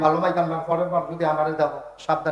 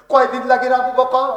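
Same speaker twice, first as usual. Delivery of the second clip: raised, high challenge; loud questioning; 7 semitones higher, 7 dB louder than usual. Acted. Abu Jahl quizzes Abu Bakr, loud high question. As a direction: fire the question loudly.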